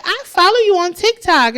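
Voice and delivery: high, loud fan gushing; excited fan. Retold or imitated. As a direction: imitate a thrilled fan who spotted someone. Acting out fan recognizing her.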